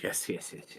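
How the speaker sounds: hushed, quick repeated yeses; whispered yes. Acted. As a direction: breathe the yeses out.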